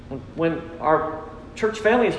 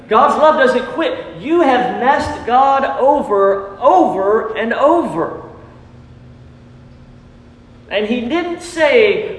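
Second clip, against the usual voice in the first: higher, louder, slower preaching push; fervent preaching; 6 semitones higher, 5 dB louder, slower than usual. Real, not acted. Preacher urging the church about God's love, fervent. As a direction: drive it home with full fervour.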